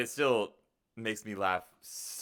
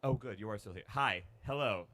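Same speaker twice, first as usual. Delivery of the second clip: ordinary talk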